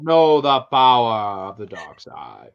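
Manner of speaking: low, slow villain voice; Darth Vader. Acted. Podcaster voicing a possessed movie villain, low slow menace. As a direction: draw it out like a deep, menacing dark lord. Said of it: "Darth Vader's possessed by Slappy".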